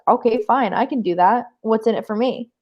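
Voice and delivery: normal talking voice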